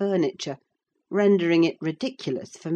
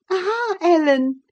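put-on much higher, louder girlish cry; 14 semitones higher, 6 dB louder than usual. A narrator's reading. Cathy greeting her nurse, gleeful cry. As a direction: cry out gaily like a delighted girl. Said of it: "she cried gaily".

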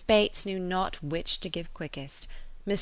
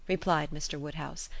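ordinary delivery

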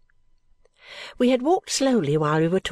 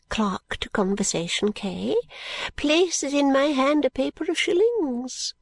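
put-on high, slow, eager old lady; an old woman sharing a wonder; fluttery old lady; 7 semitones higher than usual. Miss Flite tells of her shillings, high and eager.

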